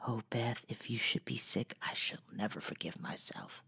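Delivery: fearful hushed whisper, dropping away; scared soft whisper; frightened whisper. A narrator's reading. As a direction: whisper it in fear for her sister. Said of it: "with a frightened look".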